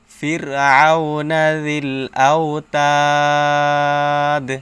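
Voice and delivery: loud, slow recitation holding "তাদ" to a bare d; stop with sakin. Shown as a demonstration. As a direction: draw out "তাদ" at length, then close on vowelless d. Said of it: "সাকিন দিয়ে পড়তে হবে". (টেনে তিলাওয়াত, "তাদ"-এর শেষে দাল সাকিন)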